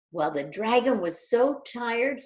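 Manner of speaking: ordinary delivery, nothing marked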